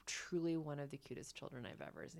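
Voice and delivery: hushed quick whisper. Real, not acted. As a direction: breathe it out in awe. Gushing about cute child.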